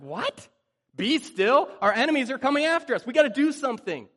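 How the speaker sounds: high, loud, fast panic; panicked outburst. Retold or imitated. The trapped Israelites panicking at Moses, high and loud. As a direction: freak out at being told to be still.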